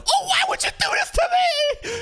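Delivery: high, loud, whining falsetto; mock whiny victim. Clapped-back commenter whining to the creator, shrill mock falsetto. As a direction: wail it like a wounded crybaby. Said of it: "they're like".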